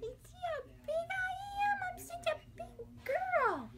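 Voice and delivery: put-on very high little girl voice; baby voice. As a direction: squeak it like a proud baby.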